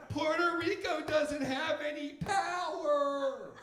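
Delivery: high, slow, loud fake lament; mock outrage. Comic mocking Americans faking grief over disasters. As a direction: drag it out in fake horror.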